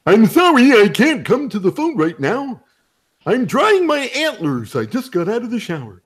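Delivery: high, cartoon moose on an answering machine; goofy cartoon moose message; Bullwinkle voice. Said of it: "you did that on an answering machine".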